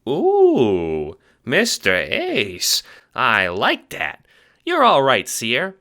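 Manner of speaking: high squeaky Italian-American chipmunk; fast-talking little mobster, squeaky; Ace, chipmunk wiseguy. Acted. Little guard talks to the Seer, squeaky wiseguy.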